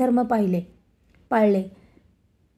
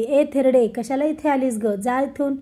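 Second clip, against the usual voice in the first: usual speaking voice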